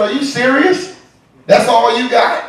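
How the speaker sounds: put-on high, loud disbelieving girlfriend. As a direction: scoff as the unimpressed girlfriend. Girlfriend mocks him.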